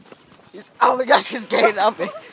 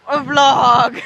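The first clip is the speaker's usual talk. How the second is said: excited; 5 semitones higher, 6 dB louder than usual. Acted. Vlog intro announcement.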